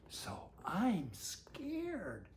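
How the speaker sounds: slow, hushed, frightened whisper; scared whisper. Acted. Reading to Sander, the astronaut whispers his fear slowly. Character admits fear in story.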